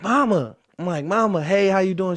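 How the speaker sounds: high, loud, bright "Mama" hello; excited greeting. Retold or imitated. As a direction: greet mom like you're thrilled she picked up. Son greeting mom on speakerphone.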